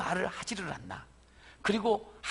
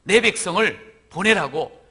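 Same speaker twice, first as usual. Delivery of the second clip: bold demand; 8 dB louder, slower than usual. A narrator's reading. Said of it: "당당하게 이야기를 하지요".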